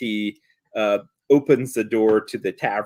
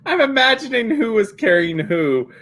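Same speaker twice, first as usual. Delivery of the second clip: talking through real laughter, high and loud; laughing; 7 semitones higher, 4 dB louder than usual. Real, not acted. Laughing while picturing them riding the bear.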